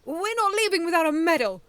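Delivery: high, loud angry snap; snapping. A narrator's reading. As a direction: snap back defiantly. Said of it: "Sea Slug snapped".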